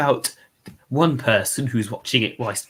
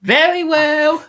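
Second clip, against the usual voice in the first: much higher, louder and slow; mock-grand impression; 6 dB louder, much slower than usual. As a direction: stretch it out grandly. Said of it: "that's actually quite good".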